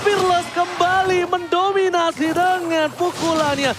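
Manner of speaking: high, slow, hyped-up call; excited shout. Real, not acted. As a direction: drag out the big call. Hype play-by-play of a knockout.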